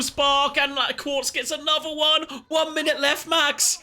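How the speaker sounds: high, rapid-fire excited commentary; esports caster. Real, not acted. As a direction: call the action as it unfolds, rising. Live play-by-play.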